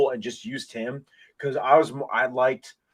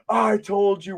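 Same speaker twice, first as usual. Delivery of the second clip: retold yell; 9 semitones higher, slower than usual. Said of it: "I'm yelling".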